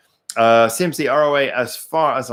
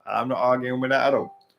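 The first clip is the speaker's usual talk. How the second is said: normal talking voice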